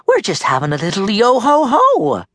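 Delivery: high, playful lilt; Molly's playful voice. A narrator's reading. Molly soothing the tied-up captain, playful high voice. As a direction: make light of it, lifting the pitch. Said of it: "Molly said".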